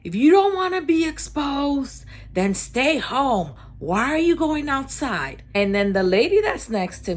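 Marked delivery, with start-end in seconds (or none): 0.0-5.4 high, loud imitated shout; mock yelling. Maskless man berating bakery customers, imitated high yell. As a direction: imitate an angry bystander shouting, pitched up. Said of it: "And he keeps yelling".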